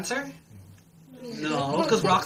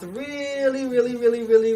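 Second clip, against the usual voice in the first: "really" repeated high and loud; 6 semitones higher, 6 dB louder than usual. A narrator's reading. Storyteller showing the crocodile's mouth widening, "really" emphatically raised. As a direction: stretch the first "really", then pile on each "really". Narrator stretches the repeated word.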